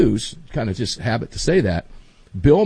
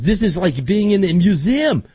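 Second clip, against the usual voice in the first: higher-pitched mock French accent; 6 semitones higher, 3 dB louder than usual. Tourist marvelling at Walmart, in a French accent. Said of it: "I'll do a French accent".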